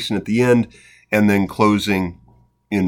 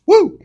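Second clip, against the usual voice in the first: short high "woo"; quick high-pitched hoot of amazement; amazed whoop; 7 dB louder than usual. Real, not acted. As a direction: let out a brief, high woo. Reacts to the shocking famine prices.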